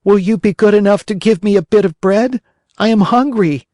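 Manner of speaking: a hungry puppet asking for bread; Pinocchio pleading. A narrator's reading. Hungry puppet begging at night.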